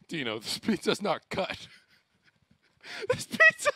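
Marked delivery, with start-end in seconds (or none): 3.1-3.7 very high, loud laughing squeal; real laughing disbelief. Real, not acted. Laughing at uncut pizza.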